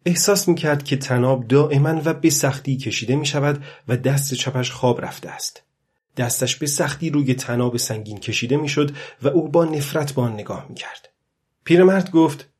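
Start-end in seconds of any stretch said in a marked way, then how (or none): none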